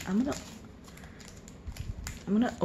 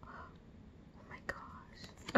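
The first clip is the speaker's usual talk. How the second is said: barely audible, breathy awe; stunned whisper; 22 dB quieter than usual. Real, not acted. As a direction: whisper it, lost for words. Stunned reaction to unboxed item.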